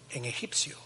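whispered aside. Real, not acted. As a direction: drop to a whisper. Hushed aside in sermon.

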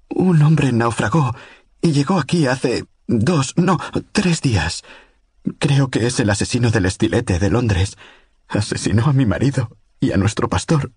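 high, urgent, frightened pleading; terrified woman's plea. A narrator's reading. Lucy begs Godliman over the radio for help, terrified. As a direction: tumble it out like a woman at the end of her rope.

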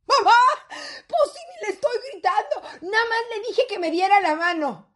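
high, exasperated daughter defending herself; defensive protest. Acted. Daughter told off for her tone, defensive protest. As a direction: protest innocence in a jumped-up pitch. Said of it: "¿Por qué le hablas así al niño?".

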